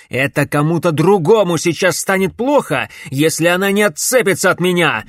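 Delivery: loud, angry growled threat; growling threat. A narrator's reading. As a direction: growl it through anger. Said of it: "прорычал Алекс".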